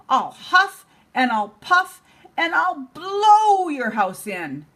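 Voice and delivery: high, slow, drawn-out huffing threat; straining coyote. Acted. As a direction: stretch it out with all his effort.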